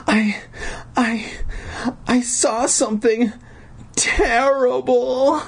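put-on high, halting frightened girl; stammering. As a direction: stammer high with pauses, drawing out the last word. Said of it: "Alicia stammered".

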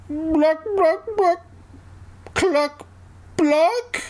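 squawked high clucking "block, block"; high chicken clucks. Acted. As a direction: cluck like a hen, high and sharp. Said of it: "he replied".

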